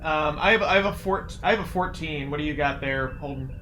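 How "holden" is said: usual speaking voice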